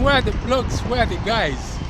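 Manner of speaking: ordinary talk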